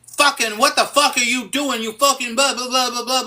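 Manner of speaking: a pretend tantrum at the gas station; mock yelling. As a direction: ham up an angry customer swearing. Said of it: "someone to yell at me".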